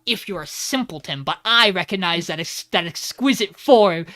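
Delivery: building from plain to loud, emphatic relish; excited hype. Real, not acted. Host praising the German suplex hold, building to loud excitement. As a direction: swell into a loud, delighted proclamation.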